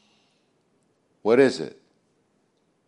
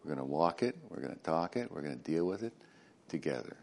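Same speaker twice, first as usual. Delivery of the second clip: much softer, low and tender promise; reassuring God; 6 semitones lower, 10 dB quieter, faster than usual. Retold or imitated. God to Moses, softly promising to walk with him. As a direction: reassure him gently, eye to eye. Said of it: "I'm gonna look you in the eye and go".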